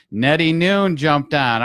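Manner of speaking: loud, stretched-out welcome; excited shout-out. Real, not acted. Host welcomes Nettie onto the livestream, excited shout-out. Host hails another viewer joining.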